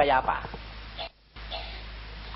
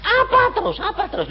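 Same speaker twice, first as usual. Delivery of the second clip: high whiny question; mock nagging; 4 dB louder, faster than usual. Believer impatiently asking God what next, high mock whine. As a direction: pester impatiently.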